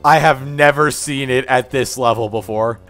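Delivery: normal talking voice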